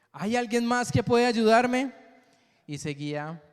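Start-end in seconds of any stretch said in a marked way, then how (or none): 0.1-1.9 frightened plea. Acted. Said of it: "él respondió".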